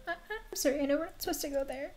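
put-on high-pitched apologetic child voice; little girl. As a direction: confess like a guilty little girl. Reads little girl Lily's lines.

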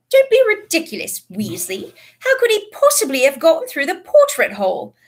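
high, loud stern disbelief; stern rebuke. A narrator's reading. McGonagall rebukes Ron.

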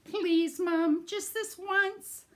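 high, loud, slow whiny plea; begging boy. Acted. Boy begging mom to let him go, whiny plea. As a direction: whine and beg like a kid. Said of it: "he says".

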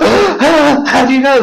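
drawn-out high, loud squeal; shocked. Retold or imitated. Acquaintance startled by Freemason remark, slow squeal. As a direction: stretch it slowly in mock alarm.

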